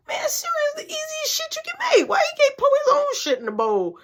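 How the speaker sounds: very high, whining mock voice; whiny complaint. Teen griping about making his brother cereal, high whiny mock voice. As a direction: gripe like a put-upon teen.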